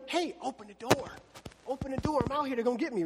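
nervous escapee begging to be let in; urgent plea. Acted. Peter outside the gate, urgent plea. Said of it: "he's going".

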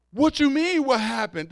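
high, loud mock offended comeback; defensive snap. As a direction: fire back as if accused. Defensive reply in retold exchange.

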